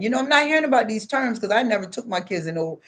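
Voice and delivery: usual speaking voice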